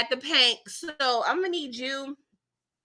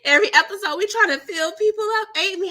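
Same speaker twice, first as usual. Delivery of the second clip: excited; 8 semitones higher, 4 dB louder, faster than usual. Real, not acted. Excited squealing commentary.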